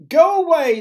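high, loud shout; frightened crowd yelling. A narrator's reading. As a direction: shout to drive a beast off. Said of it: "they shouted".

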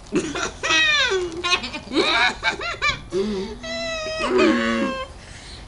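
high laugh through a full mouth; muffled squealing laugh. Real, not acted. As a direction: crack up with cheeks stuffed.

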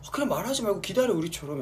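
half-hearted pouty jealous fan. Retold or imitated. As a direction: grumble jealously from the upper floor. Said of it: "약간 이런 느낌".

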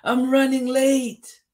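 high, loud storybook shout; bus driver's shout. A narrator's reading. Bus driver rushing Tisha along, high urgent shout. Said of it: "shouted the bus driver".

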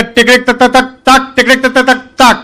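slow, deliberate clicking code; slow telegraph code. Retold or imitated. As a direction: tap out the code slowly like an old officer. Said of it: "ધોતિયાનો છેડો હાથમાં પકડીને કે".

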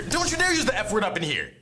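usual speaking voice

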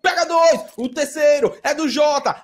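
excited, driving esports call; live play-by-play. Real, not acted. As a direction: call the kills with rising punch, stretching key words. Narra lance de e-sports ao vivo. (narração empolgada de e-sports)

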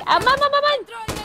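high, loud panicked burst; panicked outcry. Real, not acted. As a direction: yelp it in alarm. Panicked exclamation in game.

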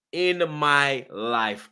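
In my life, very slowly stretched; drawn-out emphasis. Real, not acted. Fan outraged at corruption, "In my life" drawn out. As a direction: drag out each word of "In my life".

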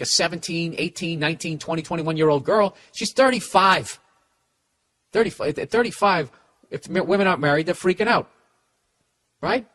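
usual speaking voice

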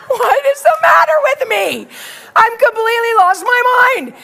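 high-pitched exasperated self-reproach; acted freak-out. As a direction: berate yourself in comic panic. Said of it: "I'm freaking out".

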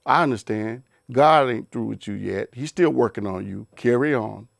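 low, gentle and forgiving. Retold or imitated. The spouse answering the wayward partner, calm and accepting. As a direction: let the spouse off kindly, pitched low. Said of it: "would your response be".

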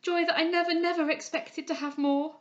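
high, loud, overjoyed outpouring; fervent joy. A narrator's reading. Henrietta kissing her friend, fervently joyful. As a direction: exclaim it fervently. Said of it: "cried she, fervently".

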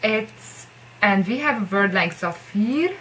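usual speaking voice

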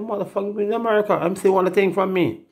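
high, pleading imitation of a beggar; mock beggar voice. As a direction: whine it up high like someone begging. His own retort quoted.

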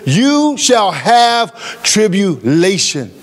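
slow, weighty, every word set down; solemn slow quote of Jesus; emphatic. Acted. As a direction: drop it slowly like a verdict. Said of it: "as emphatic as his name".

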